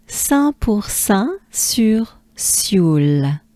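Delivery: very slow "Saint pour Saint sur Sioule" in French; French place-name demo. Shown as a demonstration. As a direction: separate each French syllable for repeating. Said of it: "Again, encore".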